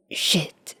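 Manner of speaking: guilty whispered curse; mumbled curse. Acted. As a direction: curse under the breath in dismay. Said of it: "Chuya mumbled".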